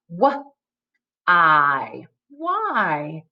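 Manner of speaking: short puffed "W", held "I", blended "Why"; "W... I... Why", sound by sound. Shown as a demonstration. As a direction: segment "W", "I", then say "Why" whole. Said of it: "what digraph makes the w".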